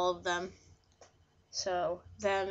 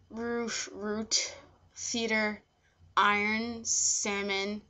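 ordinary talk, nothing marked

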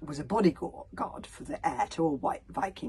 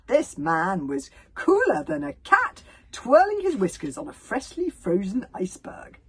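awed admiration; 8 semitones higher, 8 dB louder than usual. A narrator's reading. Stoic praising Humongous's axe work, awed.

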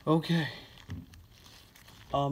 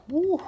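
excited woo. Real, not acted. Dizzy after blowing balloons.

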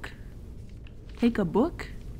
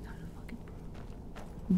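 hushed whispered grumble to self; whispering; 13 dB quieter, slower than usual. Real, not acted. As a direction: whisper it like an aside.

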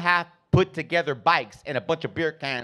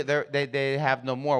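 ordinary talk

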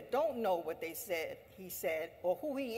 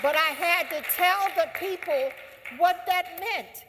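fiery speech rise; rousing; 7 semitones higher, 8 dB louder than usual. Real, not acted. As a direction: lift the voice to rally the crowd.

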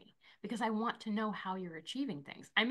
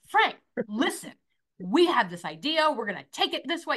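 louder, higher enthusiastic pitch; fired-up pitch; 5 semitones higher, 7 dB louder than usual. Acted. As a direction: pitch it with contagious excitement, punching the openings. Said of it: "But if I'm like".

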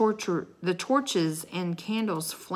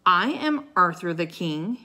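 usual speaking voice

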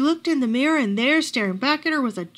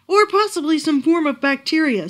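voiced expert; 5 semitones higher, 7 dB louder than usual. A narrator's reading. Expert offers a diagnosis.

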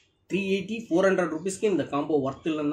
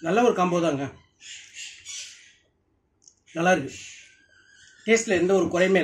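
chewing while talking; muffled between bites; mouth full; 2 semitones higher than usual. Real, not acted. Seen 1: Took a bite at -9; lips pressed, chewing between words.

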